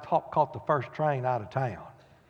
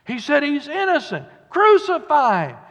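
higher, louder mob shout; 11 semitones higher, 10 dB louder than usual. Retold or imitated. Preacher voicing the mob shouting against Pilate.

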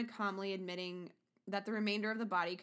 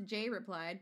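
ordinary delivery

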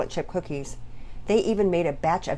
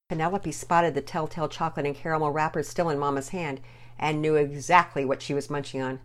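usual speaking voice

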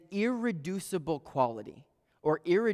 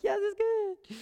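very high squeaky excitement, hammed up; excited. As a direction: shoot up into an overjoyed squeal. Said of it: "I was so excited".

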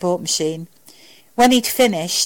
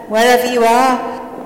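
slow, louder drawn-out farewell; sweet goodbye; 5 semitones higher, 4 dB louder than usual. A narrator's reading. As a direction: toss it out sweetly, taking your time. Woman's sweet farewell, continued.

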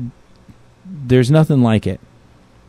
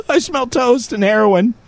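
high-pitched fake stroke patient; mock stroke victim; 10 semitones higher than usual. Man joking about a stroke, high mock-stricken voice. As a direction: play someone comically having a stroke. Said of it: "No, that's a stroke, dummy".

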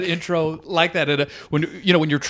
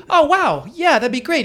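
eager; 9 semitones higher than usual. Retold or imitated. Comic accepting a fellow comic's offer, eager. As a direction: sound thrilled to be introduced. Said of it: "I'm like".

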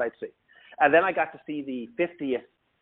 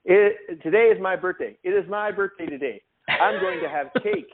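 higher, simple and childlike, lightly voiced; a hint of a dreamy, detached star; 6 semitones higher, 3 dB louder than usual. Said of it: "he looks at me and he says".

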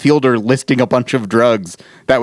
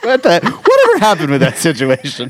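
laughing through words; words spilling out in laughter; 5 semitones higher than usual. Real, not acted. Laughing at Johnny Depp joke.

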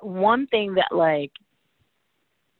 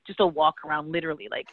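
ordinary talk, nothing marked

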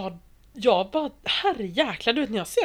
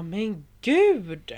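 mock surprise; 5 dB louder than usual. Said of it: "jag bara".

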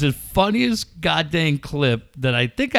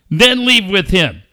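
raised, emphatic wrestler promo voice; Ric Flair; 4 semitones higher, 6 dB louder than usual. Retold or imitated. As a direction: imitate a wrestler giving brusque orders. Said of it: "where he says to a woman".

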